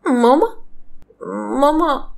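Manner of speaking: put-on high questioning child calling mama; a small girl who only knows her mother as mama; confused little girl. Child answers mother's name is mama.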